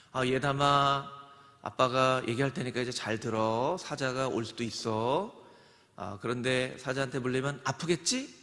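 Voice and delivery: put-on too relaxed father lecturing child; calm fatherly talk. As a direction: gently reason with a child. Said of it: "이렇게 하지 않는다는 거예요".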